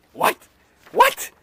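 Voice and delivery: high, loud double what; shocked witness can't believe it; stunned disbelief. Retold or imitated. Witness reacting to the lawyer's question, shocked disbelief.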